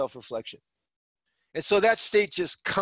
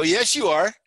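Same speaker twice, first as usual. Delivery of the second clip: louder, higher, digging in; insistent accusation; 4 semitones higher, 6 dB louder than usual. Acted. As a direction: snap back and insist. Acted quarrel, doubling down.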